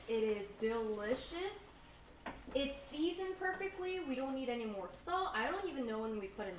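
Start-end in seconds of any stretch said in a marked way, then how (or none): 0.1-1.5 put-on slow Aussie-accented delicious; Australian accent. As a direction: drawl it like an Australian. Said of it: "now I'm turning Australian".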